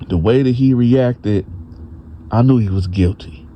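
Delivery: ordinary delivery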